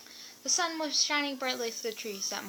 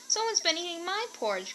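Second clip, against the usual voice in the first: put-on Baby Bear crying out; 8 semitones higher than usual. A narrator's reading. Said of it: "cried Baby Bear".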